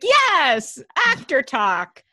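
loud, high mock cheer on the yes; delighted listener. Host imagines a friend listening while driving, hammed-up delight. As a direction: cheer like a fan alone in the car. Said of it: "She's like".

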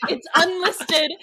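slow, loud and drawn out; emphatic. Real, not acted. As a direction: lean hard on unlisted.

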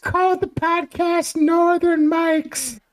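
put-on high, slow old granny voice; grandma voice. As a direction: play a sweet old grandma. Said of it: "this is David's grandma".

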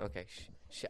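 quiet the dog down gently; hushing. Real, not acted. Hushing the dog breathing into the microphone, soft.

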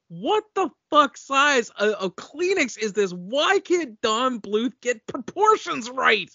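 high, incredulous outburst; exasperated rant. Retold or imitated. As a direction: throw up your hands in disbelief.

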